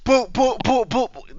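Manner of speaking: repeated "boop" sound effect; imitated booping. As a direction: pop out a string of boops. Imitates action with a spelt noise.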